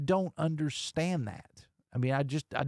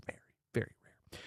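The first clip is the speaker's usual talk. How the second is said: fast whisper, no voice; whispered aside; 8 dB quieter, faster than usual. Real, not acted. Host confiding his steak order to listeners in a whisper. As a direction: whisper it like a guilty secret.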